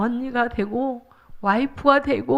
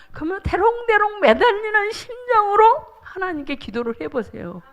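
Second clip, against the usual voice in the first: normal talking voice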